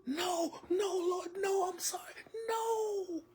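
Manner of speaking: high, crying pleas of the damned; imagined desperate screaming. Pretend. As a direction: beg for mercy, sobbing and crying out in terror. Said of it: "I can just imagine screaming".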